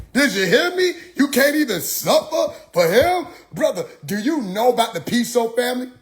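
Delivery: high, insistent mock lecturing; imitated street preacher. Preacher dodging the money question, high mock indignation. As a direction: challenge him in a high preacher voice.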